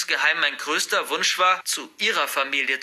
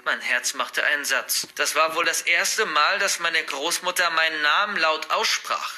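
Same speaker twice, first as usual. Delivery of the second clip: ordinary delivery, nothing marked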